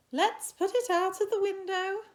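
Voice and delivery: high, loud teacher voice; teacher calling out. A narrator's reading. Teacher tells the class what to do, high loud call. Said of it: "said the teacher".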